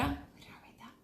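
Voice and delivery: breathy whispered aside to herself; a soft puzzled whisper. Real, not acted. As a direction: drop to a whisper, wondering aloud.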